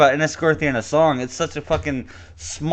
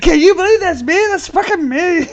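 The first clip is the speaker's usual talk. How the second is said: much higher, squeaky and incredulous; 12 semitones higher than usual. Pretend. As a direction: squeak it out in disbelief. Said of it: "By the way, I don't sound like that".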